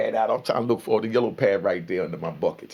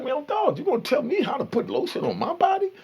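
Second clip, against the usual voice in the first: mock indignant. Cellmate quarrel, retold.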